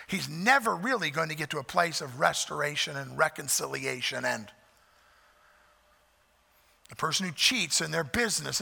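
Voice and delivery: ordinary delivery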